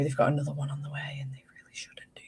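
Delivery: hushed, fast gossipy whisper; whispered gossip. Real, not acted. Gossiping about friends, whispered.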